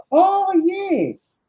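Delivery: high, loud burst of remembering; sudden high-pitched realization; excited recollection. Real, not acted. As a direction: exclaim as it comes back to you.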